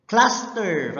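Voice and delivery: teacher spelling out cluster; slow teaching stress. Shown as a demonstration. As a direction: model word Cluster for the class. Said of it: "from the word cluster".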